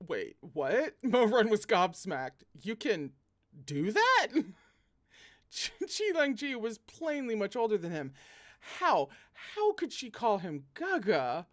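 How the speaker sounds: loud, high disbelief; incredulous. Real, not acted. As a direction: gasp it out in shock. Shocked reaction to text.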